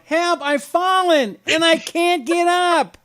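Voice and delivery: put-on high, slow elderly cry for help; old woman in distress. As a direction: call out like a fallen old lady. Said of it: "that old woman in that commercial".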